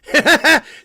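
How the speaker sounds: real laughter at a friend's joke; loud laugh. Real, not acted. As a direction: burst of high, loud laughter. Laughing at the birthday exchange.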